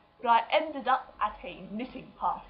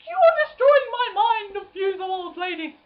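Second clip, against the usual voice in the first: high, loud mock outrage; mock horrified; 11 semitones higher, 8 dB louder than usual. To the knitting ladies, high loud mock outrage. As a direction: cry out in comic dismay at the old ladies. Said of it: "I was like".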